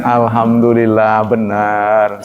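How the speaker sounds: reply humbly and peacefully; calm sincere answer. Shown as a demonstration. How a seller should answer a question about his scales, calm and sincere. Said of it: "Katakan aja begitu".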